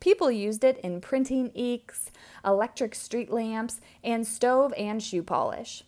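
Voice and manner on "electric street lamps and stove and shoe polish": ordinary delivery, nothing marked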